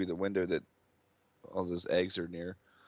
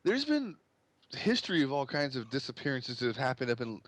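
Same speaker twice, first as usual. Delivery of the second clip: higher-pitched outraged dad; upset, indignant father; 6 semitones higher than usual. Acted. Father answering an accusation against his daughter, indignant. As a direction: argue back as an offended, protective father.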